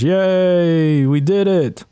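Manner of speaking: ordinary talk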